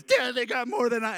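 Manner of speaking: put-on high-pitched complaining child; whining kid. Kids protesting to dad over food portions, high childish whine. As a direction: mimic a kid griping to dad. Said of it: "my kids are always".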